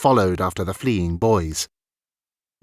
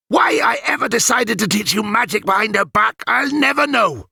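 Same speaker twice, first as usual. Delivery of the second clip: grumbling rant; 11 semitones higher than usual. A narrator's reading. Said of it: "ranting under his breath".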